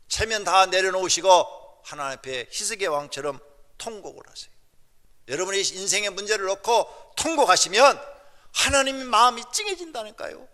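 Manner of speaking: loud exhortation climbing to a shout; full-force preaching. Real, not acted. Preacher urging congregation to weep like Hezekiah, full-force preaching. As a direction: build to a high, very loud plea.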